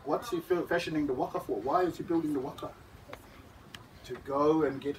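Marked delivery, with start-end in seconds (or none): none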